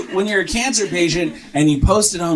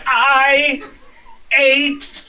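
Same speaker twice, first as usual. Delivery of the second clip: panicked confession; 11 semitones higher, much slower than usual. Retold or imitated. High husband confessing to his wife, panicked. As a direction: blurt it word by word in terror.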